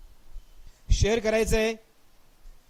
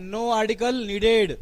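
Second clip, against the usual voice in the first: very slow, spelled-out answer; much slower than usual. Shown as a demonstration. Teacher states answer slowly. (सावकाश उत्तर)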